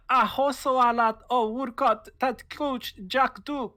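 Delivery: high, loud alien language reading. Acted. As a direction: sound out the strange Dinosaur words. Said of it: "the Dino language written out".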